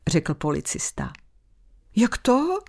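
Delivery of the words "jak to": high surprised jak to; surprised child. Acted. Lucka asks the policeman in surprise, high and puzzled. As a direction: ask it puzzled like a little girl. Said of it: "zeptala se udiveně Lucka".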